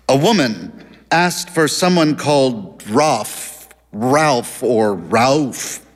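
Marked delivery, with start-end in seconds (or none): 2.9-5.8 "Ralph" tried slowly in differing pronunciations; unsure pronunciation. A narrator's reading. Narrator puzzling over a caller's unclear name, unsure pronunciation. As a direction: draw out each "Ralph", changing how it sounds. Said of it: "he couldn't figure out the name".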